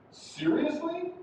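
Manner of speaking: slow "Seriously?" going up high; rising intonation. Shown as a demonstration. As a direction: stretch "Seriously?" and lift it upward. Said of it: "Again, that's going up".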